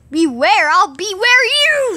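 rising into a high, loud shout; mock threat. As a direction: build to a drawn-out menacing yell.

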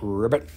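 low croaking ribbit, imitating a frog. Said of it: "Sapo means a frog".

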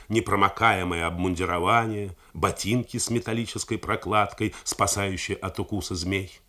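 usual speaking voice

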